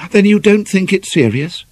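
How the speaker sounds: high, eager rising question; hope creeping into the question; hopeful. A narrator's reading. Ivy hopefully asks the doctor whether it's serious, eager.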